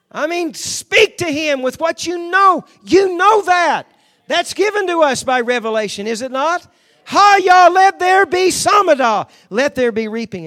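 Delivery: loud, high-pitched charismatic declaration; full-force preaching. Real, not acted. Preacher declaring God is on the move, full-force shouted preaching. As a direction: proclaim it at a shout, stretching the sacred words.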